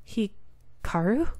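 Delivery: whisper-call his name in disbelief; soft stunned call. Acted. Finds Hikaru sobbing in shadows.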